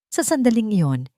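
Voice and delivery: ordinary delivery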